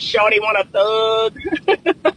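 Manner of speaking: slow, lightly voiced imitation of a podcaster's catchphrase; imitating Theo Vaughn. Vlogger quoting Theo Vaughn's line to viewers, slow light imitation. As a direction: draw it out slowly, a loose imitation. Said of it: "I think of Theo Vaughn".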